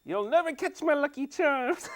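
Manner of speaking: high, loud Irish leprechaun cereal-ad voice. Pretend. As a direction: squeal it like the cartoon leprechaun. Jokes the prayer ends like the cereal ad.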